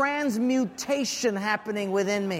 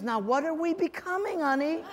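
amused wife; 6 semitones higher than usual. Retold or imitated.

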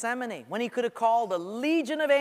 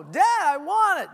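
put-on higher, louder childish whine; whining child; 10 semitones higher, 8 dB louder than usual. Child demanding a thing from dad, whining. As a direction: nag like a demanding kid.

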